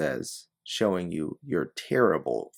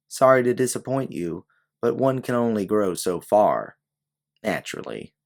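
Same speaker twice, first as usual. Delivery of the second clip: haughty woman; 5 semitones higher, 6 dB louder than usual. Acted. Woman's haughty dialogue read aloud.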